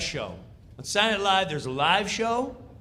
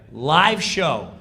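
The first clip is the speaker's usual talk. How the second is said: ordinary talk, nothing marked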